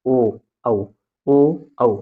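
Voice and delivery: "ओ", then "औ", each a separate rounded vowel; vowel demonstration. Shown as a demonstration. Teacher shows students how these letters are spoken, vowel demonstration. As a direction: sound out "ओ" and "औ" separately, pausing between rounds. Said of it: "जैसे बोलते हैं आप".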